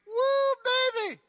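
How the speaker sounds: wild excited cry; excited whoop. Real, not acted. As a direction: holler it out in delight. Preacher reacts to crushing verdict.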